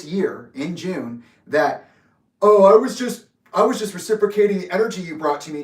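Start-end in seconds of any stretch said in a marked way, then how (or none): 2.4-5.6 loud, high mocking quote; scornful mimicry, pitched up; mocking imitation. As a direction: parrot their excuse derisively, voice raised. Mocking retold excuse from an opponent.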